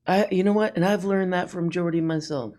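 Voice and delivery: ordinary talk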